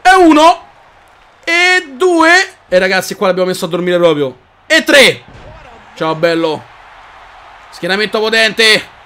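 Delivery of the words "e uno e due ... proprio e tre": shouted count. Real, not acted. Counting suplexes again.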